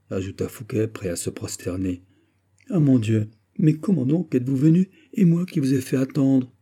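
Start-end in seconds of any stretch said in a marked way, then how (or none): 2.7-6.5 higher, louder, exclaiming in dismay; amazed and apologetic, pitch leaping up; flustered amazement. Acted. Fouquet realises he kept the marquise waiting, flustered.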